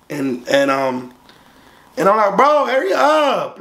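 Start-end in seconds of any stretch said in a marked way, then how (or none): 2.4-3.6 high, drawn-out impatient heckle; acted-out needling, slow and high; retold trash talk. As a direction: hassle him to hurry, pitched way up. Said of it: "I'm, like, talking shit".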